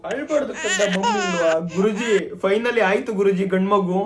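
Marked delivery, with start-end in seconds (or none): 0.1-1.5 put-on lulling baby. Mother lulls baby.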